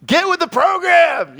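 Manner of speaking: high, loud, slow parental shout; imitating a shout. Father imitating himself yelling at his son, loud. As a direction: bark it at your kid, exasperated. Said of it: "just shouting at him".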